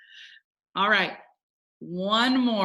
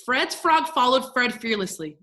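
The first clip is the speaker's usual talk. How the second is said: quick tongue-twister recitation; super fast; 2 semitones higher, 4 dB louder than usual. Shown as a demonstration. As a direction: race through every word. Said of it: "Let's say it super fast".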